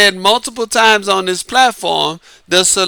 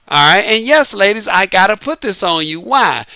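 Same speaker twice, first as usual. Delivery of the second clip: normal talking voice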